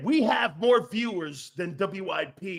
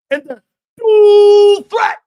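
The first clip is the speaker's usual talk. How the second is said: much higher and much louder, slow; hyped shout; 12 semitones higher, 17 dB louder, slower than usual. Real, not acted. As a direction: blast it like a highlight call. Hyping the quarterback.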